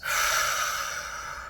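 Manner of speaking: long, airy exhale; breathing out. Shown as a demonstration. Guide breathing out along with relaxing listeners, audible exhale. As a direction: let a deep breath go slowly. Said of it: "as you breathe out".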